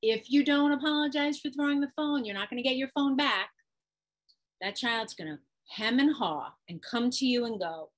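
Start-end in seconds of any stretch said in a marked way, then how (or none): 0.0-3.5 higher, louder parental threat; acted stern parent laying down the law; warning parent. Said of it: "the parent usually has some consequence".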